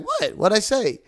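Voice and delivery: squeaky, very high what; innocent surprise. Retold or imitated. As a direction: play dumb, startled. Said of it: "she's like".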